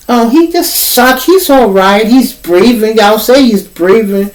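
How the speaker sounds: high, put-on nurse voice; mock careless nurse; dismissive nurse. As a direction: brush off the patient.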